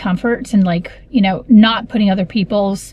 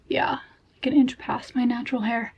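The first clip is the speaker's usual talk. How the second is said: whispering; 3 semitones higher, 7 dB quieter than usual. Real, not acted. Whispered aside while showing length.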